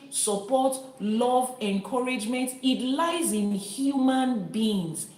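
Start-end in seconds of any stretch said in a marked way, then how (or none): 2.6-5.1 human drawn out slow and high; slow, weighty "human beings" with the stress on "human". Real, not acted. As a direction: lean hard on the word "human". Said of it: "Emphasis on the word human".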